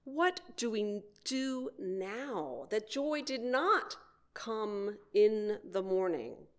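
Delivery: usual speaking voice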